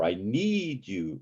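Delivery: I need you with NEED drawn out; stress on NEED. Shown as a demonstration. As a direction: stretch and lift need in I need you. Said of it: "same thing with".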